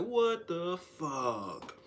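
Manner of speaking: very slow, drawn-out loud "What the fuck"; getting loud. Retold or imitated. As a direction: drag each word out as you raise your voice. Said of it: "I started getting loud".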